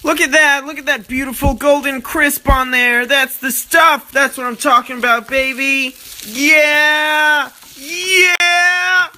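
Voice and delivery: high, loud gloating over food; excited hype. Acted. Hyping up his sizzling food.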